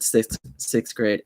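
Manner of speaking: ordinary talk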